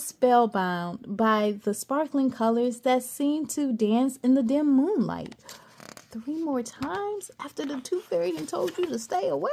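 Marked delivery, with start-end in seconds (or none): 6.1-9.5 mock scolding, higher and softer; chiding aside. Narrator to listeners about Cassie, chiding. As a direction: tut at her like an auntie.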